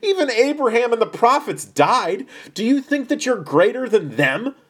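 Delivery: scoff, climbing high and loud; incredulous challenge, rising in pitch and volume. A narrator's reading. Doubters challenge Jesus over Abraham and the prophets, scoffing. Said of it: "They scoffed".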